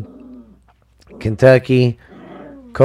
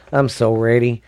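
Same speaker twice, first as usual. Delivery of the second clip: ordinary talk, nothing marked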